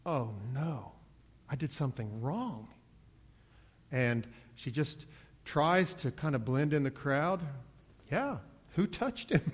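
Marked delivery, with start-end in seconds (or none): 0.1-2.7 small, softened voice of dismay; mild mock worry; nervous. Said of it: "imagine this nervous, retiring woman". 8.1-9.4 high, playful mock-innocent question; light comic innocence; feigned innocence. Woman feigning innocence in the crowd.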